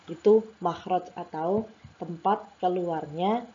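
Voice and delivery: normal talking voice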